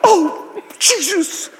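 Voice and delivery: very high, slow, astonished shout; amazed Peter. Pretend. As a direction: lose your mind with wonder. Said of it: "You're going".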